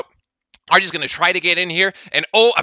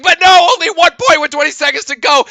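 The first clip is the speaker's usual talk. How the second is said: higher, louder dismay; alarmed call; 8 semitones higher, 5 dB louder than usual. Real, not acted. Commentator watching the lead vanish, alarmed. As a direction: cry out at the shrinking lead.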